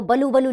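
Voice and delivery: बलू बलू said as ba-loo ba-loo, wrong vowel placement; wrong pronunciation. Shown as a demonstration. Teacher showing children the misspelt बलू बलू, quick wrong reading. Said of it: "बलू बलू नहीं बुलबुल".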